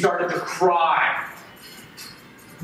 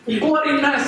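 "Bloody mess" spat out high and bitter; mock the offering with disgust; raised, scornful "Bloody mess"; angry sneer; 6 semitones higher than usual. Contemptuous repeat in rant.

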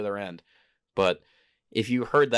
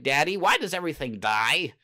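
put-on high, louder childlike puppet voice; puppet child voice; 7 semitones higher, 3 dB louder than usual. Puppet child asks Daddy about assassination, in a high kid voice. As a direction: play a curious little kid puppet asking Daddy. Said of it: "having little puppets saying".

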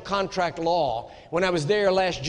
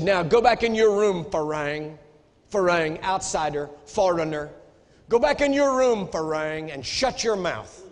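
retold angry order; 4 dB louder than usual. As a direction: bark it like the man throwing him back in his room. Threatening man orders him away.

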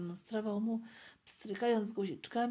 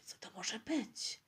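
slow, wondering whisper; whispering back; 3 dB quieter than usual. A narrator's reading. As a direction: whisper the question slowly. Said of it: "odszepnął Piotruś". (powolny szept)

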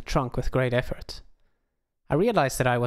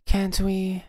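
put-on higher, slower Southern belle accent; Southern flirt; 7 semitones higher than usual. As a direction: drift into a Southern drawl to sweet-talk the cop. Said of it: "my accent drifted a bit south".

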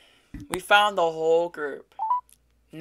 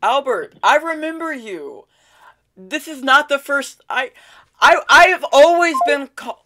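excited taunt; 7 semitones higher, 5 dB louder than usual. Acted.

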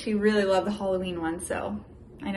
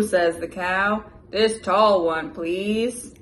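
lively, loud picture-book read-aloud; animated storybook voice for a little one; reading to a child; 3 semitones higher, 6 dB louder, slower than usual. Acted. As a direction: read it big and bouncy for a toddler.

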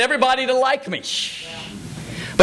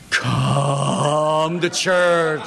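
very long, droning sing-song call; hammed-up slow chant; exaggerated mimicry; 5 semitones lower, much slower than usual. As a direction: drag every word out mockingly, low. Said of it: "He just exaggerates it".

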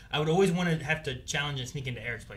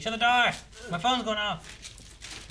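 yelling roommate; 6 semitones higher, much slower than usual. Retold or imitated. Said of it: "She's like".

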